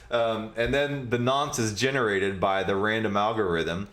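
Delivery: normal talking voice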